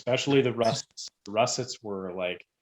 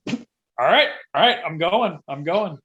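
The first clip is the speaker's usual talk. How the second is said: mock hurried; 7 semitones higher, 7 dB louder, slower than usual. Hurrying to obey the boss.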